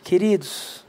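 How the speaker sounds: lightly imitating a refined speaker; mock eloquent preacher. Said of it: "imagina se eu subisse agora e eu falasse".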